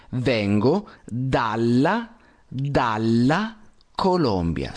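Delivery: slow "Vengo dalla, dalla Colombia", every syllable spelled out; careful model sentence. Shown as a demonstration. Teacher dictates the correct phrase slowly for Diana. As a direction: say "dalla" slowly and clearly, dal-la. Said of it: "te deberías decir".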